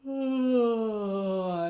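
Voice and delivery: loud yawning ah. Shown as a demonstration. Coach yawning to open the throat. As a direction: yawn it open. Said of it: "Work into a yawn".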